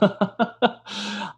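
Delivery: a short high mocking laugh; amused laugh. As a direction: laugh at the bad acting. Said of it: "Very amusing".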